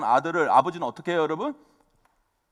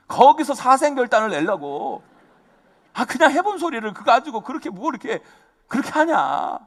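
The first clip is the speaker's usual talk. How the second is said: ordinary talk, nothing marked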